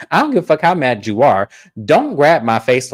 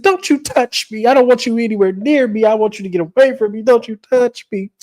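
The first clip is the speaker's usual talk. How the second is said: high-pitched mock outrage; hammed-up frantic brush-off; hysterical rejection; 9 semitones higher than usual. Lisa repels Brittany's comfort, hysterical.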